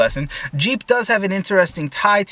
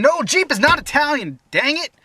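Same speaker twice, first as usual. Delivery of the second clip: much higher, exasperated protest; exasperated outburst; 11 semitones higher than usual. Real, not acted. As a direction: pitch shooting up in frustration. Exasperated rebuttal of common myth.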